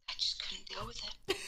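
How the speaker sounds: soft breathy whisper of defeat; exasperated whisper. Real, not acted. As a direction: breathe it out, worn out by it. Exasperated confession, voice drops to whisper.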